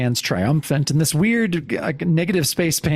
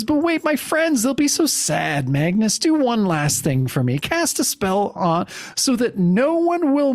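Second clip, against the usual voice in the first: high, dramatic acted plea; hammed-up heroine's entreaty; pleading; 8 semitones higher than usual. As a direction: beg a last favour in a raised voice. Dying heroine pleads for friends.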